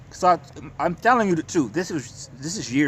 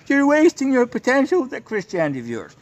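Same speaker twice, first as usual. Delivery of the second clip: much higher and louder, sneering caricature; mocking imitation; 10 semitones higher, 4 dB louder than usual. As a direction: parody them, shrill and scornful. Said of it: "to them, Christianity is a fucking mental illness".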